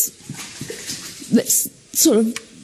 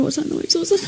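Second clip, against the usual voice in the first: ordinary talk, nothing marked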